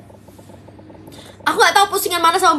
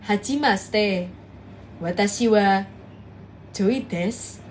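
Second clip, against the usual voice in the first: lower, slow Japanese intro, Indonesian style; Indonesian doing Japanese; 7 semitones lower, 5 dB quieter, slower than usual. Retold or imitated. Streamer mimics the old trend of Indonesians speaking Japanese, low and slow. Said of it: "orang Indo ngomong pakai bahasa Jepang".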